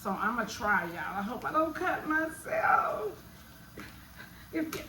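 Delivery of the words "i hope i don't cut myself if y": high, anxious hammed-up worry; mock fearful. Cook fretting to viewers before cutting onions, high mock worry. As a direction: play up nerves before slicing.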